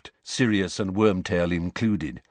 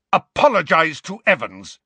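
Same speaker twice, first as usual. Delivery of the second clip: high, loud, angry order; roared; 7 semitones higher, 5 dB louder than usual. A narrator's reading. James threatens Snape on Lily's behalf, roaring. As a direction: bellow the demand. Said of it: "James roared at Snape".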